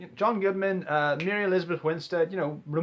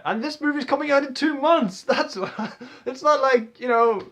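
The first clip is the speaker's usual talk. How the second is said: laughing; 9 semitones higher, 6 dB louder than usual. Real, not acted. Laughing excitement about release.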